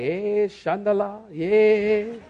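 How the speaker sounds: drawn-out congregant babbling in tongues; slow, droning imitation of praying in tongues; mock tongues-speaking. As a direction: lightly parody a worshipper in tongues. Mimicking people speaking in tongues.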